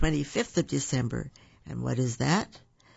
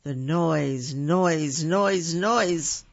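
louder and louder; 4 dB louder, much slower than usual. A narrator's reading. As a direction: pound out every noise. Repeated noise getting louder.

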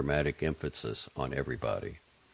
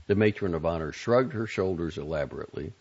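ordinary delivery, nothing marked

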